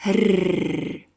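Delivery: rrr trilled at the tongue tip; rolled r. Shown as a demonstration. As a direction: roll the rrr hard. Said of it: "Say it after me".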